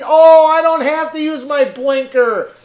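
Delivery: loud, high whiny put-on voice of a careless driver; mock entitled driver. Comic mocks a driver who won't signal, in a loud whiny put-on voice. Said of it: "mocking a bad driver".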